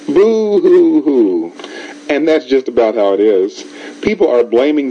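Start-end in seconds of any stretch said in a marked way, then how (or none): 0.1-1.5 slow, hammed-up fake sobbing; drawn-out mock boo-hoo crying. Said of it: "And he was crying".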